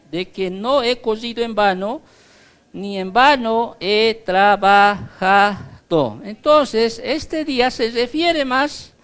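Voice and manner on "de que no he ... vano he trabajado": scripture reading. Acted. Reads Philippians aloud.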